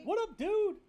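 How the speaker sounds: high, loud hey-buddy hello; excited greeting. Real, not acted. Greeting a new viewer by name.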